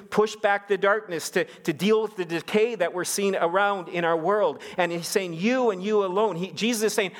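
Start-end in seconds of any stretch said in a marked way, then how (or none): 5.3-6.4 high, insistent YOU AND YOU ALONE; emphatic. Retold or imitated. Preacher repeating Jesus' words to stress them, emphatic. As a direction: lift YOU and press it home. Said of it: "emphatically here".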